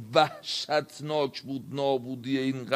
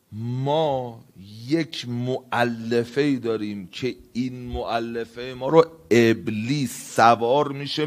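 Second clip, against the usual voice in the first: ordinary talk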